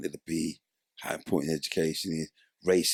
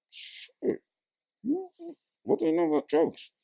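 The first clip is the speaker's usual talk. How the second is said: shocked. Retold or imitated. Shocked by kid's question.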